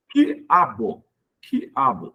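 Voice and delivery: "Quiabo" broken as QUI, A-BO; I separated from A. Shown as a demonstration. Teacher showing a hiatus, vowels separated. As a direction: leave a small gap after QUI in "Quiabo". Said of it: "O i separa do a".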